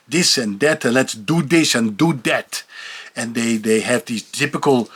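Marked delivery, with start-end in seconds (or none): 0.1-2.6 "this" and "that" as "dis" and "dat"; hard D in place of TH in "this" and "that"; TH becomes D. Shown as a demonstration. Demonstrating the Dutch habit, D for every TH. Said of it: "The T H becomes a D".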